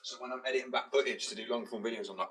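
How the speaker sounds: ordinary delivery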